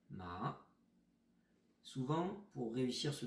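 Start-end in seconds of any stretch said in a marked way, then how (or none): 0.1-0.6 ma rising like a questioning hein; second tone ma. Shown as a demonstration. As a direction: lift ma upward as if asking. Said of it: "si on fait un deuxième ton". (ma au deuxième ton, montant)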